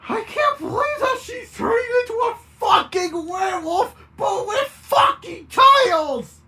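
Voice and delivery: disbelieving scream. A narrator's reading. Bert screams in disbelief at the Baroness. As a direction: scream it in disbelief, near tears. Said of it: "Bert questioningly screamed".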